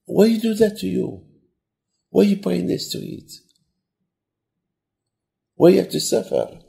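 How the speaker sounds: high, plaintive questioning; acted tearful lament. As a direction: wail the questions gently in distress. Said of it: "he starts crying".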